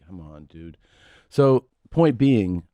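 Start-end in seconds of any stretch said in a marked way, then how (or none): 0.1-0.8 tired mutter. Retold or imitated. Dad retells night complaint.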